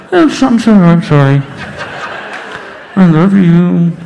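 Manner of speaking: loud, muffled snorkel voice; loud, tube-muffled mumbling; talking through a snorkel. Retold or imitated. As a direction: imitate talking with a snorkel in your mouth. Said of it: "I'm talking to her through the snorkel".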